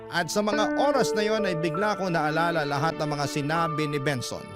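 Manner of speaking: ordinary talk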